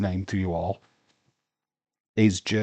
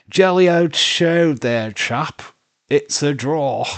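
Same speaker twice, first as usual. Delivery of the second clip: mock upper-class English accent, higher and louder at the start, slow; posh British; 5 semitones higher, 6 dB louder than usual. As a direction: ham up an old-fashioned British gent calling it a draw. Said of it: "in good old-fashioned Brits form".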